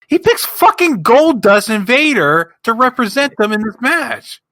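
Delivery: high, loud, incredulous outburst; exasperated rant. Real, not acted. Wrestling fan rants about who was picked for the match, exasperated. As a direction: pitch shoots up in exasperated disbelief. Ranting at absurd team choice.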